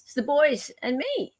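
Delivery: ordinary talk, nothing marked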